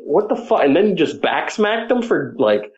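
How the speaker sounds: ordinary talk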